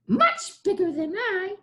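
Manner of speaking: high squeaky goblin threat; goblin warning. Acted. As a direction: warn him off like a sly little goblin. Goblin warns of bigger goblins.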